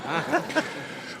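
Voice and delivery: a high laugh that rises at the end; amused laughter after a joke; real laugh. Real, not acted. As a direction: laugh at your own punchline.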